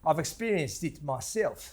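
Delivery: clipped Afrikaans-tinged retelling; South African accent. Retold or imitated. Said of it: "Oh, that's very good".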